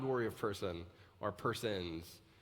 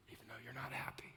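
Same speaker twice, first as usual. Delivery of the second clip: soft, voiceless whisper; a quiet confiding whisper; whispered aside; 12 dB quieter, faster than usual. Real, not acted. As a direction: let it slip out under the breath.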